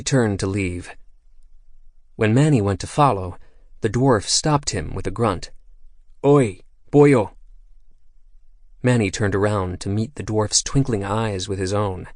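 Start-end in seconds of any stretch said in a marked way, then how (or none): 6.2-7.3 higher, louder gruff call. A narrator's reading. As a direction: stop him with a sharp call in brogue. Dwarf calls Manny back.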